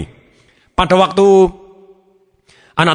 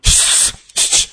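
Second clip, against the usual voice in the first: urgent hushing; much slower than usual. Acted. Embarrassed papa quieting his child, hushing. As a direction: imitate an embarrassed parent shushing.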